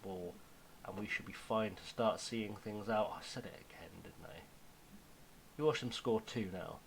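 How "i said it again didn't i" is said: soft, hushed near-whisper. Real, not acted. Jinxing his own lead again, hushed whisper. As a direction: breathe it out as if afraid of the jinx. Jinxed himself a second time.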